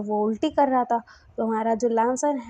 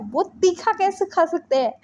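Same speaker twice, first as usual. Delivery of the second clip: amazed disbelief; 7 semitones higher than usual. Real, not acted. Narrator marvels he eats spicy.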